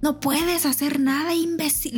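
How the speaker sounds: high, goading imitation of a bully; re-enacted aggressive jeering; mock angry taunting. Acts out abusive man taunting her.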